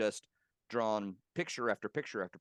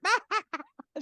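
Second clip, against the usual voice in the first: big high imitated guffaw; 9 dB louder than usual. Imitating Deborah laughing at the chick joke, loud mock guffaw. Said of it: "She guffawed".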